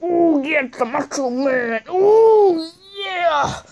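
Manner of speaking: hammed-up wrestler catchphrase, swelling at the end; Macho Man. Wrestler shows he does it better, showy mock impression. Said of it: "'cause he does it better. He's like".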